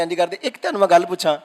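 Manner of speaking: ordinary talk, nothing marked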